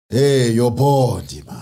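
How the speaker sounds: put-on slow, loud South African accent, laughing. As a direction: ham up a South African slang line. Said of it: "you bodied that accent".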